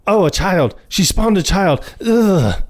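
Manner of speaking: put-on high, disgusted gasp at a child; mock disgust. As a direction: recoil in exaggerated horror. Retelling disgusted character's reaction.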